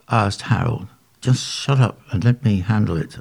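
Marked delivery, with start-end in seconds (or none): none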